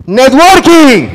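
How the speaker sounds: loud, drawn-out desperate cry; one long shouted word, begging for air. Acted. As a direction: yell it like you're out of air and drowning. Gasping for air while drowning.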